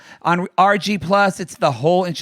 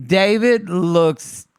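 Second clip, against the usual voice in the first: ordinary talk, nothing marked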